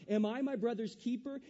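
high, loud mocking retort; loud sarcastic Cain. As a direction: throw the question back at God with scorn. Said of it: "he's very sarcastic with God".

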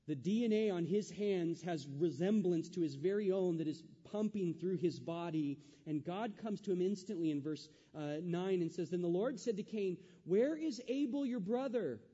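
loud, rising preacher's voice; booming sermon delivery; full-force preaching. Acted. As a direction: preach it out to the congregation.

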